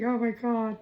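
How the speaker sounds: high-pitched, mock-tearful exclamation; retold tearful reaction. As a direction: re-enact being moved to tears. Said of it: "I was in there crying".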